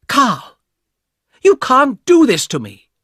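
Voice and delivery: high, loud furious shout at her brother; angry shout. A narrator's reading. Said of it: "shouted at the man".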